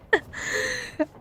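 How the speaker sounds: a quick high giggle; short amused laugh; light laugh. Real, not acted. Laughs at own taco habit.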